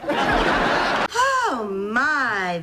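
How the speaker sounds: over-the-top pretend shock; mock surprise. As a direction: fake being caught off guard. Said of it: "I better put a surprised look on my face".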